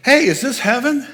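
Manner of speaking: high, loud eager call. Retold or imitated. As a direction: call it out, excited, to Kevin. Ghost player calls to Kevin.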